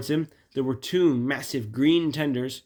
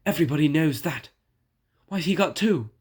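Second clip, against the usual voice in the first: quick, higher jealous whine; complaining; 4 semitones higher, 4 dB louder than usual. Acted. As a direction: grumble fast, rising in pitch. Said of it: "he complained".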